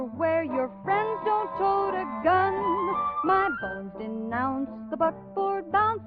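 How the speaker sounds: ordinary talk, nothing marked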